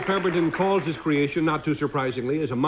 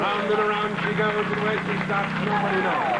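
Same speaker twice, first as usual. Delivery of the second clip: raffle caller; 6 semitones higher than usual. Acted. Spinning wheel, calling out the draw.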